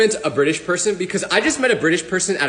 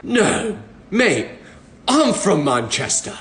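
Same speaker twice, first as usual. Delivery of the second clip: put-on British accent; much slower than usual. Stranger replies in a British accent. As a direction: answer back with a Mancunian British accent. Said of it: "they were like".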